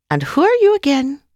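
put-on high-pitched, questioning old Irish lady; elderly Irish lady. A narrator's reading. Elderly medium asks visitors on her porch, high old-lady voice. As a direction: ask like an old lady hard of hearing. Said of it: "elderly Irish lady".

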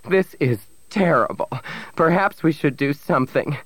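high, appalled voice; shocked, fretful plea; gasped dismay. A narrator's reading. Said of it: "Sarah Theron gasped".

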